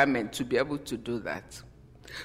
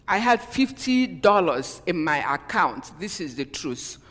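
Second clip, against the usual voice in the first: ordinary delivery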